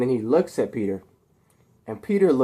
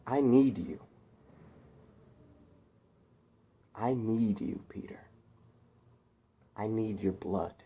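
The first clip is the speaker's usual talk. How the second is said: very soft, halting plea; hushed menace; 9 dB quieter than usual. Acted. Harry asking captive Peter for his blood, hushed menace. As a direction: drop to a near-murmur, with long pauses.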